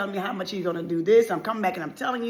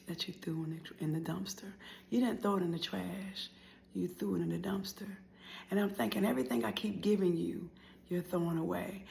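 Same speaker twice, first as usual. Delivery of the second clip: very soft, whispery recollection; quiet, wounded murmur; hurt hush; 10 dB quieter than usual. Acted. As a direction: drop almost to a whisper. Hurt aside after quarrel.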